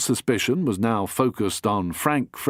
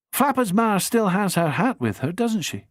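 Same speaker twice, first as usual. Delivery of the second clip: a lightly voiced young woman, curious and higher; young woman's voice; 8 semitones higher than usual. Amy presses Bingo about the hat, lightly voiced higher. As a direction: ask it brightly, pressing gently.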